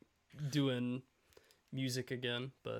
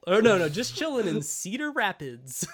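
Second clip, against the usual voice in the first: mock politician; 5 semitones higher, 3 dB louder than usual. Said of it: "politicians trying to relate".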